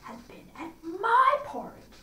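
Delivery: a lightly voiced mother bear; middle bear. A narrator's reading. Narrator voicing the middle bear complaining about her porridge. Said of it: "in his middle voice".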